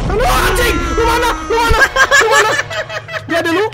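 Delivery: high, frightened shouts for a friend; real high-pitched panic; panicked screaming. Real, not acted. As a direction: scream for your missing teammate in a panic. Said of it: "Lu udah teriak dua kali barusan".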